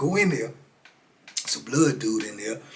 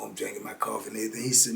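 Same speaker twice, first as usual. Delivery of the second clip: normal talking voice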